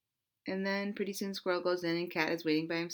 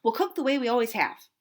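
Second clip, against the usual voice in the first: loud, quick and firm; stern cat; 4 semitones higher, 6 dB louder, faster than usual. Acted. Cat insists to Duck and Squirrel, loud and firm. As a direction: lay down the law fast.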